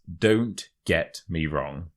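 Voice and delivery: word-by-word "Don't get me wrong"; measured demonstration, "Don't" set apart; slow model phrase. Shown as a demonstration. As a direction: present the phrase slowly for learners. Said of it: "the third expression is".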